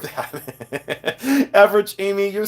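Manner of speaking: slow words dissolving into laughter; real, tickled laugh through the words. Real, not acted. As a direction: crack up as you say it. Cracks up at a viewer's name.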